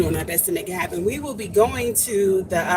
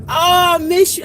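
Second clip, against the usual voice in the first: excited greeting; 9 semitones higher, 6 dB louder than usual. Real, not acted. Greets friend again.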